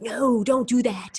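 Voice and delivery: slow, drawn-out motherly warning; mom's warning. Retold or imitated. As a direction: stretch it out as a mock scolding mom. Mom scolding in retold story.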